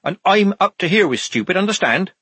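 high, loud sergeant's telling-off; shouting. A narrator's reading. Sergeant Polly dressing down the soldier, shouting. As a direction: shout it as an exasperated reprimand. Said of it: "shouted Polly".

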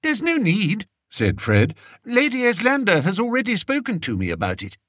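high, fretful king voice; a spoiled king fishing for comfort; vain fretful king. A narrator's reading. Fred answers Spittleworth.

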